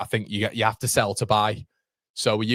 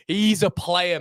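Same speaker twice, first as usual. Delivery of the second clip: ordinary delivery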